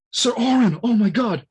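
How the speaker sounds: high, loud starstruck gasp of awe; starstruck fan. Retold or imitated. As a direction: gush like a fan meeting his hero. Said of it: "And Waka is like".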